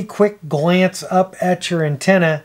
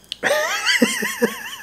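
high-pitched laugh; 5 dB quieter than usual. Real, not acted. Laughs at himself on lockdown.

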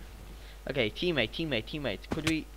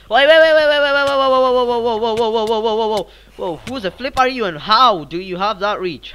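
loud, high rapid-fire stammer of alarm; frantic alarm; 8 semitones higher, 13 dB louder than usual. Real, not acted. Gamer caught by an opponent's reach, frantic and startled. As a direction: start very high and loud, gradually sliding down into protest.

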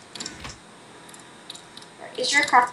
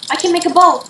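excited; 5 semitones higher, 7 dB louder than usual. Real, not acted. Sudden idea mid-game.

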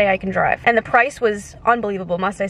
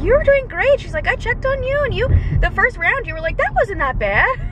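much higher, sweet encouraging voice; sweet doctor; 11 semitones higher than usual. Retold or imitated. Nice doctor praising her patient, high and sweet. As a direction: coo it encouragingly. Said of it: "she was so nice".

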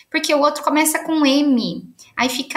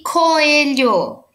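"coelho" stretched very slowly, syllable by syllable; slow teacherly sounding-out of "coelho"; drawn-out syllables; 2 semitones higher, 3 dB louder than usual. Shown as a demonstration. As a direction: draw out each syllable of "coelho". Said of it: "é só pra gente separar".

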